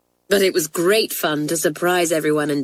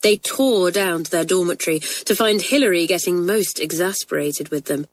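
ordinary delivery

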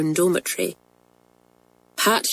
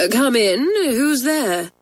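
slow, higher-pitched surprised call; 6 semitones higher, slower than usual. A narrator's reading. Teacher behind the door to unexpected knockers, surprised. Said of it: "A surprised voice called".

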